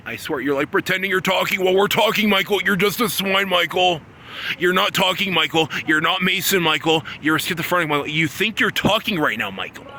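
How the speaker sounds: shouting insults at Michael over and over; furious rant. Real, not acted. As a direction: tear into him without letup. Berating Michael in a rising rant.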